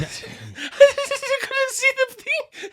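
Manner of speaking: high, squealing laugh-talk; mock impression. Cohost doing an impression on the podcast, squealing and laughing. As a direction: laugh right through the words in a squeaky high voice.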